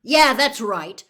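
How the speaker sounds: loud, slow, furious shout; fed-up shout at his tormentors. Acted. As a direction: bellow it back as you wheel around. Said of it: "Harry found himself shouting".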